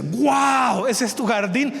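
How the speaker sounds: high, astonished exclaim; stunned neighbor marveling at the garden; amazed wow. Acted. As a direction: gasp at the sight of it.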